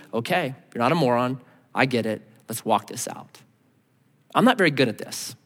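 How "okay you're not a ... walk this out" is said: gentle reassurance. Pretend. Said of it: "a spirit of gentleness means".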